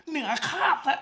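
เหนือคาดนะ said almost under the breath; soft aside of เหนือคาดนะ through a laugh; hushed catchphrase. Real, not acted. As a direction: drop เหนือคาดนะ to a near murmur. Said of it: "เหนือคาดอะ".

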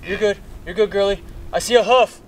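high, thrilled cry at the first hoof; squealing with joy as the hoof shows; excited. Real, not acted. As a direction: pitch way up, bursting with excitement.

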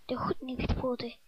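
stemloos gefluisterd; fluisteren. Acted. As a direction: fluister het geheimzinnig. Fluisterend uitgesproken. (Erik fluistert het zacht na)

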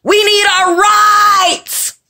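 a hammed-up mock shout of protest; mocking whiny complaint. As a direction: parody the complainer's whine. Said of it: "hung tight about rights".

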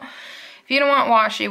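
normal talking voice

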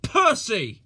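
loud angry shout. A narrator's reading. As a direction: call him out sharply. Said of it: "he shouted".

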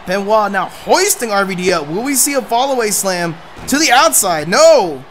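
loud, rising play-by-play shouting; excited wrestling commentator. Acted. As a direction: hype up the submission like a ringside announcer. Excited call of a submission hold.

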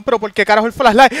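ordinary talk, nothing marked

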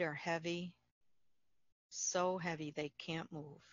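calm, unhurried meditation voice with long pauses; guided meditation. Acted. Facilitator guiding listeners through Yoga Nidra, calm guided meditation.

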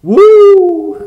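whooping then laughing; high excited whoop. Real, not acted. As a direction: cheer with a squeal.

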